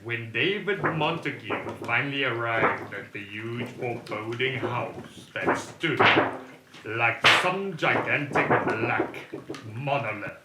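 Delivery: slow, dramatic horror narration; spooky audio drama narrator; story narration. A narrator's reading. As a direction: paint a dark, foreboding scene.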